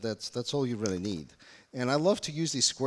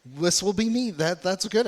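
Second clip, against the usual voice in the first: excited; 7 semitones higher, 6 dB louder than usual. Real, not acted. Excited about oil people in audience.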